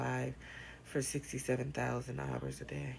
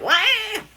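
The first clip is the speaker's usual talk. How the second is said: loud high mock wail; a short loud fake baby cry; imitated baby wail; 14 dB louder than usual. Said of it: "be like".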